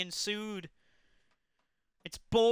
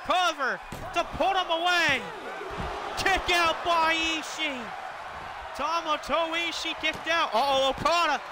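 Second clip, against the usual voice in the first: high, excited play-by-play calling; wrestling commentator; 5 semitones higher than usual. Acted. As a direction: call the big moves hyped up, ringside. Live play-by-play of a wrestling match.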